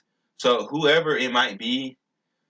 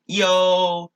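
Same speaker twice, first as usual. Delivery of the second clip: high, long-held "yo"; drawn-out calling; 6 semitones higher, 4 dB louder than usual. Pretend. Speaker demonstrates his word for goodbye, high and drawn out. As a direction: call it out on a high sustained note.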